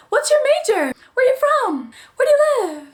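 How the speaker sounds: very high-pitched mock student chirp; mock small-talk voice. Imitating freshmen meeting each other, high mock small talk. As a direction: sing out each question in a squeaky put-on voice. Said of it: "the conversation that you have with everybody that you meet".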